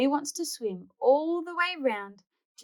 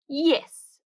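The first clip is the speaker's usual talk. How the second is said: put-on happy kid; 6 semitones higher, 6 dB louder than usual. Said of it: "agrees Bluey".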